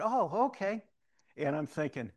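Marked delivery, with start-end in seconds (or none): 0.0-0.8 mock surprise. Surprised by clerk's answer.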